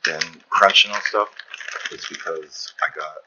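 ordinary delivery

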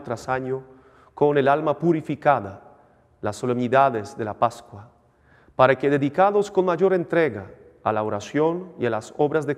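measured priestly recitation; formal liturgical prayer. Acted. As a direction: intone it like a priest at mass. Priest recites eucharistic preface.

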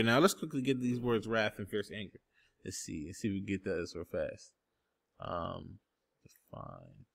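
low, very soft self-talk while searching; talking to himself. Real, not acted. Preacher to himself while looking up words, low and hushed. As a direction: drop low and quiet, voice trailing away.

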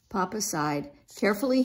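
ordinary delivery, nothing marked

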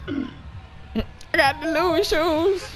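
very high, slow squealed mimicry; high drawn-out imitation, not shouted. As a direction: stretch it out in a mock squeal. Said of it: "got loud as shit for no reason".

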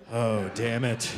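very low, slow mock dismay; resigned curse. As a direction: sink into a deep, drawn-out grumble. Acted regret over morning coffee.

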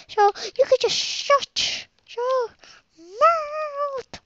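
exaggerated high, loud dad impression; mock your dad's protest in a silly high voice; complaining dad. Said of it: "my dad was complaining about that last episode. He was like".

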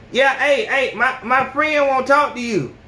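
speaking up for her shy friend; mock girl for her friend. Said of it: "Hey, boy".

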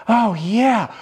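high, loud mock moan; romantic moan. Shown as a demonstration. Coach recapping the romantic version, hammed-up moan. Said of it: "a romantic way of saying".

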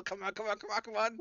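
loud, high, rushed rattle; frantic urging. Acted. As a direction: hurry the spell along at the top of your voice. Game master races through frantic ritual.

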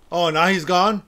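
loud, high surprised question. Real, not acted. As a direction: react to him vanishing. Figure vanished.

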